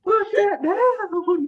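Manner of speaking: high, slow, laughing echo; laughing imitation. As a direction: repeat it high through laughter. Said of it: "kind of whisper yell".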